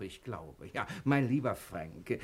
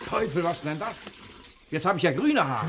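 high, loud alarmed exclamation; startled dismay; shocked outburst; 6 semitones higher, 4 dB louder than usual. Acted. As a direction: cry out at the sight of green hair. Hair turns green while dyeing.

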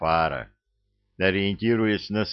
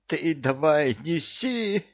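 wheezy; 8 semitones higher, slower than usual. A narrator's reading. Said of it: "просипел Кевин".

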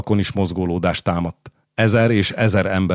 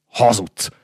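restrained roar, raised pitch; roared accusation; 4 semitones higher than usual. A narrator's reading. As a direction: fling the accusation, only mildly shouted. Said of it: "üvöltötte Kármányóla".

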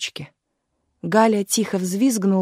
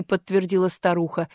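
ordinary delivery, nothing marked

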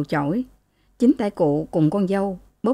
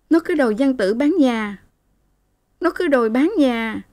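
put-on high, aggrieved young woman's voice; complaining daughter; 6 semitones higher than usual. A narrator's reading. Daughter tells her mother her husband wants the house sold, high and aggrieved. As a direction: repeat the grievance insistently.

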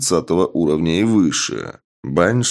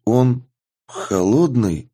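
unsure; 5 semitones higher, slower than usual. A narrator's reading. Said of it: "неуверенно произносит Трейсер".

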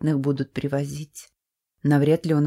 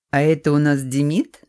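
loud booming greeting; 5 dB louder than usual. A narrator's reading. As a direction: boom it out loud and hearty. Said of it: "раздался громогласный голос".

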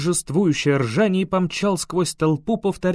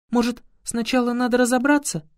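put-on a young woman trying to calm things; gentle girl's voice; 7 semitones higher than usual. A narrator's reading. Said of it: "попыталась вмешаться Лайла".

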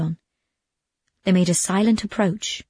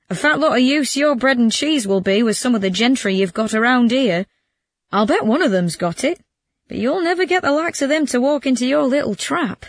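lightly voiced scoffing friend, pitched up; scoffing Bruno; 5 semitones higher than usual. Bruno doubts the bread and cheese trial, scoffing. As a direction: pour mild scorn on the plan.

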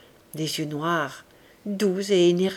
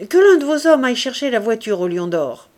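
firm, louder command; loud order; 4 semitones higher, 8 dB louder than usual. A narrator's reading. Commissaire ordering his gendarmes to fetch the carriage, loud and firm. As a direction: bark out the order to the men. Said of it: "ordonna-t-il".

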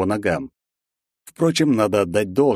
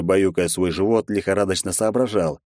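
plain reading voice

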